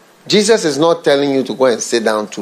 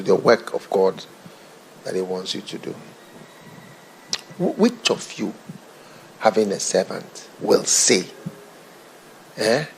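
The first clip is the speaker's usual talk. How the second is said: ordinary talk, nothing marked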